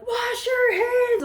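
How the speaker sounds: high, drawn-out yell; mock shouted order. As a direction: yell it slowly as an exaggerated command. Restaurant staff order, acted.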